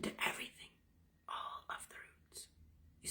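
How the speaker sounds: breathy unvoiced whisper of the roots. Real, not acted. Guiding listeners through the tree analogy, whispering. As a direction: hush it to a whisper.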